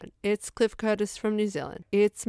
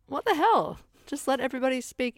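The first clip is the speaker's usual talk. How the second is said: exasperated reaction; 8 semitones higher than usual. Retold or imitated. Exasperated retelling of own reaction.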